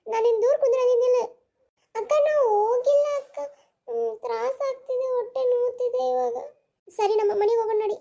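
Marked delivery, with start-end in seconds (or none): 1.9-6.4 put-on crying child. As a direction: whimper it through tears to the big sister. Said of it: "ಅಳ್ಬೇಡ". (ಅಕ್ಕನ ಮುಂದೆ ಅಳುತ್ತಾ ಹೇಳುವ ಮಗು)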